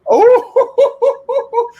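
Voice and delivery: high, loud, laughing "oh"; excited whoop. Real, not acted. Asked about John Cena's return, excited whoop.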